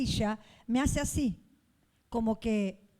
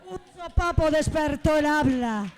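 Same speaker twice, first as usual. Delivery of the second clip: preaching climax; 3 semitones higher, 5 dB louder, slower than usual. Real, not acted. Climax of the healing story.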